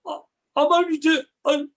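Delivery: high, sheepish monster voice; monster's reply. Retold or imitated. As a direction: dream monster answers in a high meek voice. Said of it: "and he goes".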